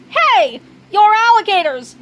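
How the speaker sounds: loud, high exclamation of discovery; shocked discovery. Acted. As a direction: blurt it out in alarm. Discovering disguised intruders.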